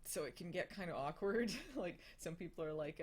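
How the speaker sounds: ordinary delivery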